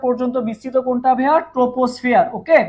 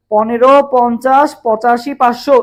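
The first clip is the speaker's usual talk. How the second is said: slow, louder chant, one number at a time; slow number drill; 5 dB louder, slower than usual. Shown as a demonstration. Teacher leading students through the layer heights, slow louder chant. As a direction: spell out each number for the class to repeat. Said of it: "সবাই আমার সাথে সাথে comment করো".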